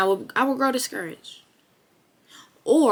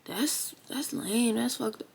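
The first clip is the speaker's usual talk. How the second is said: soft, breathy whisper to herself, much softer; deflated whisper; 8 dB quieter, much slower than usual. Retold or imitated. Comparing herself to a bigger channel, a deflated whisper. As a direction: let the words sink under your breath, defeated. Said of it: "or I'm gonna feel like".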